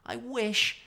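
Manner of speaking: high, bright wish; a little girl starting a wish; Mickey wishing. A narrator's reading. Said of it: "said Mickey".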